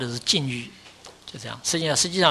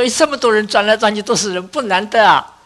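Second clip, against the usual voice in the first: higher, louder mock brush-off; mimicking a listener who dismisses rebirth as easy; dismissive quote; 6 semitones higher, 6 dB louder than usual. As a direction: voice a casual skeptic waving it away, hammed up. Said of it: "说".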